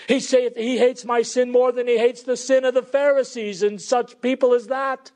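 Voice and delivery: high, insistent pulpit voice; full-force preaching. Real, not acted. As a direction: preach it with mounting fervour, hammering each phrase.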